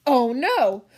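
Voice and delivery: ordinary talk, nothing marked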